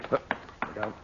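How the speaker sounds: ordinary delivery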